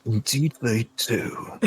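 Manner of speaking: slow, drawn-out satyr voice; leering satyr. Acted. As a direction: draw it out as a lecherous satyr. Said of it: "the satyr says".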